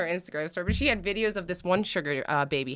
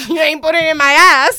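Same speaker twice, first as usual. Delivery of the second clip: higher, loud laughing quip; laughing punchline; 9 semitones higher, 15 dB louder than usual. Real, not acted.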